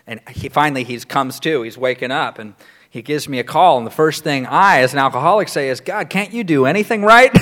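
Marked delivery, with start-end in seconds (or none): 5.8-7.4 higher, louder jab for a laugh; mock exasperation. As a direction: scold him in comic disbelief. Acts out alcoholic's exasperated first reaction.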